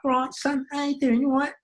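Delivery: high-pitched Cockney put-on; Cockney geezer. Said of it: "This is gonna be a Cockney geezer".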